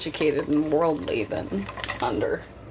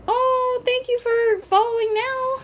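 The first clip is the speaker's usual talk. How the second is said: excited thanks. Real, not acted. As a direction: gush at the new follower. Thanks a new follower.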